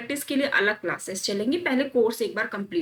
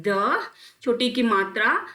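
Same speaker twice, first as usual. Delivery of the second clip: slow spelling out; slower than usual. Shown as a demonstration. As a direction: spell out आधा ग और जा और ल very slowly. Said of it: "बच्चों की तरह पढ़िए".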